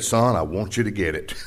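mother gently insisting to her son, low and calm. Retold or imitated. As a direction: say it calmly, like a mother making her point. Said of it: "Very calmly she said".

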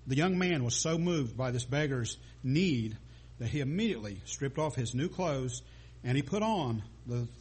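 ordinary talk

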